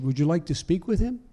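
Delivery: put-on breezy invitation between old pals; chummy offer. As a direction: offer it like an old friend would. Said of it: "as if we were old friends".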